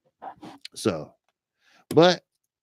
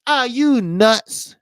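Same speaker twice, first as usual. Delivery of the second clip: incredulous; 8 semitones higher, much slower than usual. Real, not acted. Disbelief at fleeing from agents.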